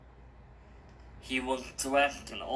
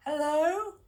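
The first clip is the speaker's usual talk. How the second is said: put-on high, louder girl's call into the house; 5 dB louder than usual. A narrator's reading. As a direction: call into the dark house, much higher and louder. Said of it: "she called".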